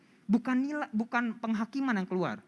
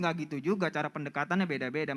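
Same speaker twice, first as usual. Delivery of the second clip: ordinary talk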